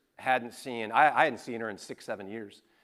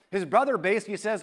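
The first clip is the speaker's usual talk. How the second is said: ordinary talk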